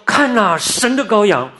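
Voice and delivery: high, loud proclamation; calling out. Retold or imitated. Preacher voices the cry heard by the lonely and helpless, calling out. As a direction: cry it out as a sudden announcement. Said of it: "突然听见说".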